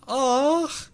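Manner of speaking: loud, high "aw"; high adoring squeal. Pretend. Streamer hearing a viewer's cat loves the horn, adoring squeal. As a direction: squeal it like melting over a cute pet.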